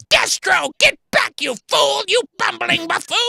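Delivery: very high, loud screeching villain; hammed-up cartoon supervillain screech; Cobra Commander. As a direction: shriek insults at incompetent underlings. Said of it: "Fucking Cobra Commander".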